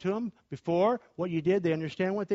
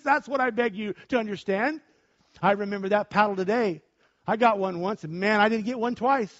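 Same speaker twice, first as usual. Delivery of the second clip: normal talking voice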